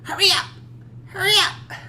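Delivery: shrill frantic cry, very high; Joyce impression. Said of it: "That's her voice".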